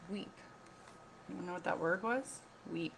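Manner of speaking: plain reading voice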